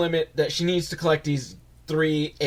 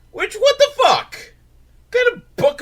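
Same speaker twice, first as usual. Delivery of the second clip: much higher, louder incredulous cry; shocked outburst; 6 dB louder than usual. Real, not acted. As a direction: yelp it in disbelief. Reacting to the book's message.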